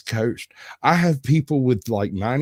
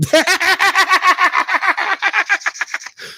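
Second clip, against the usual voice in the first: long high laugh he can't hold back; helpless laughter; 4 dB louder than usual. Retold or imitated. Patient apologizing to the nurse, still laughing helplessly. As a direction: laugh it out, unable to stop. Laughing through painful treatment.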